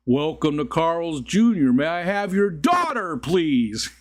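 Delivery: slow, carefully enunciated drive-thru greeting. Retold or imitated. Coworker Jeremy slowly enunciating the joke greeting at the drive-thru. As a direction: say each word slowly and clearly. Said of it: "he said it so slow and, and really enunciated".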